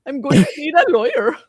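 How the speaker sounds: loud mock Indian accent; Deepak Chopra impression. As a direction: ham up the Chopra voice. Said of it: "I can't do Deepak".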